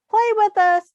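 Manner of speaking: high, loud playful call. Acted. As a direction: call him over from the tree. Squirrels calling to Pinky.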